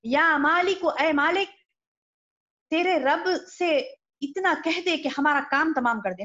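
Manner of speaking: calling out. Retold or imitated. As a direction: act out calling up to the keeper of hell. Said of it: "وہ پکار پکار کر کہیں گے".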